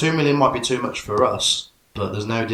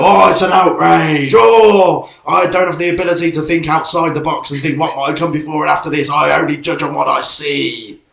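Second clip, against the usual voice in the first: loud, high, hammed-up mock outrage; outraged online commenter; 6 semitones higher, 9 dB louder than usual. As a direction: wail it like an indignant social media moaner. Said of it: "on Twitter and Facebook, they go".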